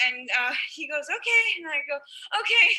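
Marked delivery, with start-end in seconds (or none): 1.1-1.4 a much higher, louder mock-squeaky okay. Boyfriend accepting the dinner invite, squeaky high okay. 2.4-2.7 squeaky okay. Retold or imitated. Girl answering the boyfriend, squeaky high okay. She answers him back.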